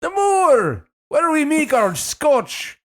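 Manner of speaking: very high, loud, drawn-out mock Scottish; hammed-up Scottish parent, stretching the vowels; bad Scottish accent. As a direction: fake Scottish, slow and high. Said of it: "Can't do Scottish".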